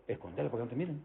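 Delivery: very soft, furtive urging; inner voice. Acted. Preacher voicing a thief's inner voice urging concealment, very softly. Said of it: "Allá adentro vos".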